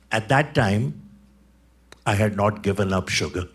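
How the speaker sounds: ordinary talk, nothing marked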